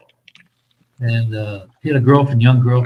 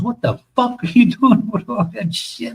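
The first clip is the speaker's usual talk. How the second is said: high, raised sergeant voice breaking into a laugh; mock sergeant outburst, laughing; 10 semitones higher than usual. Old sergeant scolding a soldier over desserts, laughing mock outrage. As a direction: retell the sergeant's outrage while cracking up. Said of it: "He goes".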